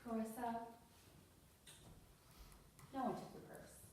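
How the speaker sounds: lightly voiced officer, quietly dismissing a complaint; dismissive police officer. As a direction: play it down quietly, like an unbothered officer. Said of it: "that police officer looks at you and goes".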